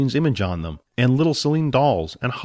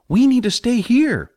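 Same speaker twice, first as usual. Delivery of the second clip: firm insistence; 12 semitones higher, 4 dB louder than usual. Acted. Jack insists slowly.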